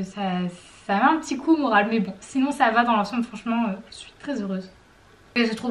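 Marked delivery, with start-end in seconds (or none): none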